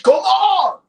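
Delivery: high, loud cheering yell with a laugh; rallying shout. Real, not acted. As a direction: roar the team on. Cheering the team on.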